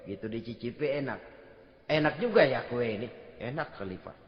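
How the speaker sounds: hushed, savouring the cake; low murmured enjoyment; quiet tasting. Retold or imitated.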